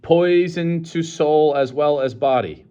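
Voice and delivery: solemn warning. A narrator's reading.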